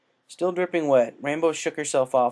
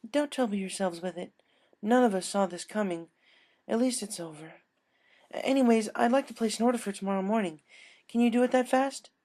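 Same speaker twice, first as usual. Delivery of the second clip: higher, soothing then businesslike; consoling customer; 10 semitones higher than usual. Acted. Customer consoling the baker then ordering, higher voice. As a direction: comfort her, then place the order.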